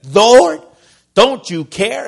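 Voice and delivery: loud, high, fed-up complaint with hands on hips; exasperated demand. Acted. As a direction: call him out like a fed-up sister. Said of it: "this lady is fed up".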